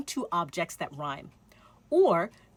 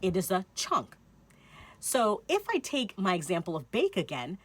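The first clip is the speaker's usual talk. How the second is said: ordinary delivery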